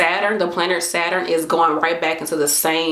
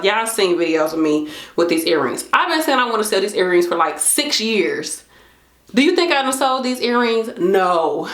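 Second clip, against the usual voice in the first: ordinary delivery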